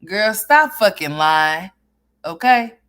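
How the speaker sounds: slow, loud, sassy call-out; calling her out. Real, not acted. Calling out a liar.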